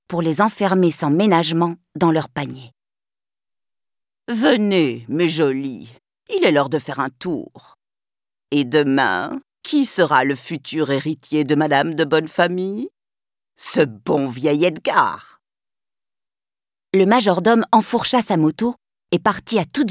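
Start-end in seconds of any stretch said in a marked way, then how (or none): 4.3-15.4 low, sly scheming butler; gloating villain. Acted.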